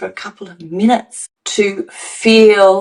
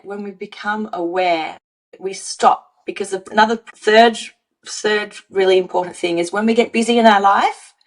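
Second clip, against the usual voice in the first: normal talking voice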